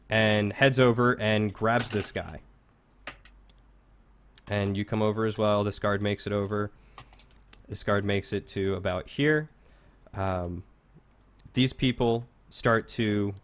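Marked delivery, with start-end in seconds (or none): none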